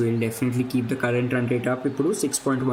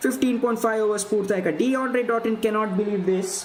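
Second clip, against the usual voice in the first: excited cricket commentary; 9 semitones higher than usual. Real, not acted. Wicket falls, commentator excited.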